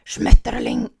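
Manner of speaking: Schmetterling barked very low and loud in a guttural German; harsh German. Shown as a demonstration. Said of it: "it even hurts the throat to say it".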